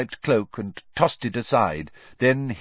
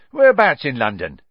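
a bit higher and louder, chirpy conductor; lightly voiced Stan; 4 semitones higher, 8 dB louder than usual. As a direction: ask it like a breezy bus conductor. Stan asks the destination.

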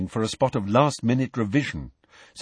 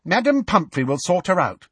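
higher, confident reassurance; firm schoolgirl's voice; 8 semitones higher than usual. A narrator's reading. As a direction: state it firmly to settle the worry. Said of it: "said Alicia Spinnet firmly".

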